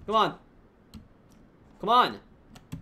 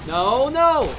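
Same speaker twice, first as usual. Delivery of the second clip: loud, raised cry of no; alarmed shout; 4 semitones higher, 9 dB louder than usual. Real, not acted. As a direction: shout it out to stop what's happening. Calls out in alarm during game.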